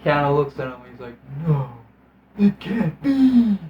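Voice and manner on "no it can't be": slow, hushed, hammed-up denial; mock stunned disbelief. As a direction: imitate a dazed hero refusing to believe it. Mimics Keanu's stunned disbelief.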